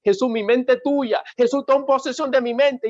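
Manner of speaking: clamor fuerte y muy agudo; declámalo en el clímax, a toda voz; oración ferviente. Retold or imitated. (entrega fervorosa, en tono alto)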